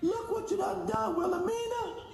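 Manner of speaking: high, soft falsetto scold; mock scolding. Customer scolding his failed company in a high mock voice. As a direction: chide her like a fed-up auntie.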